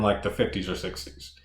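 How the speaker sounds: normal talking voice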